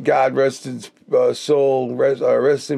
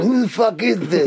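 higher, rough mock Tom on the phone; imitating Tom; 6 semitones higher than usual. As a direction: bark it like the confused Tom. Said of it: "Russ loves when I imitate Tom".

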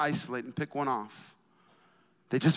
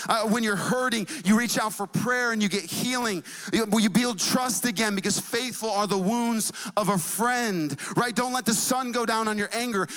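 raised, driving preacher's cadence; preaching; 5 semitones higher than usual. Real, not acted. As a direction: urge the congregation in pitched-up, insistent phrases. Preaches to congregation.

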